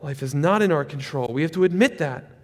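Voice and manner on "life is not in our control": usual speaking voice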